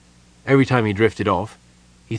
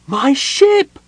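very high, loud outraged shriek; gnome's shriek; 7 dB louder than usual. Acted. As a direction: shriek it far above your normal pitch. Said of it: "let out a shriek".

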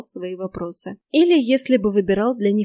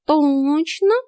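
angelic tone; 6 semitones higher, 5 dB louder than usual. A narrator's reading. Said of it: "ангельским тоном уточнил кот".

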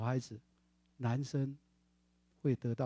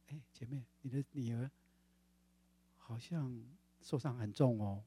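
hushed concern; 3 semitones lower, 6 dB quieter than usual. Retold or imitated. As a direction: lean in and say it quietly. 对母亲轻声说. (讲道者轻声提醒那位母亲)

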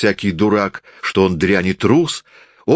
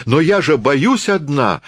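frightened cry; 7 semitones higher than usual. A narrator's reading. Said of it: "крикнула Зойка".

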